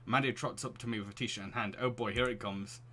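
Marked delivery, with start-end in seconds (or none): none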